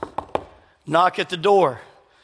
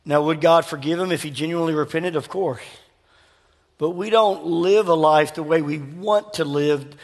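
ordinary delivery

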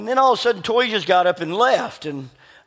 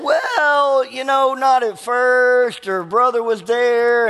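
sheepish reply; 6 semitones higher, much slower than usual. Retold or imitated. Caught, answering pastor sheepishly.

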